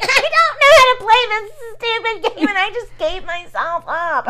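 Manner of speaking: high, slow, whiny mock Kim; imitating Kim. Friend mimicking Kim's whiny excuse to the host, high and slow. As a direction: mimic the friend's helpless complaint. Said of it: "and Kim's like".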